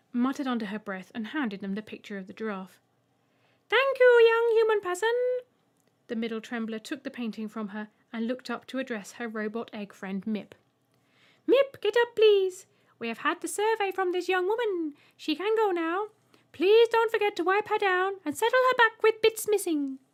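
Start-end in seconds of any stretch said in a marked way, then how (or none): 3.7-5.1 high, loud squeaky alien creature; an odd little alien asking; high alien trembler. Acted. 11.5-20.0 high, loud squeaky alien creature; an odd little alien asking; high alien trembler. Acted.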